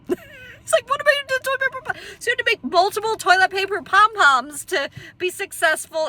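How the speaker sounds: real helpless laughter, words squeaked high; cracking up. Real, not acted. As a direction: struggle to speak through laughter. Cracking up over pom-pom punchline.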